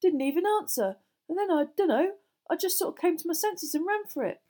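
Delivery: high, loud, flustered confession; worked up and mortified; flustered boy. Acted. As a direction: recount it in a high, agitated boy's voice. Narrator voices a mortified boy.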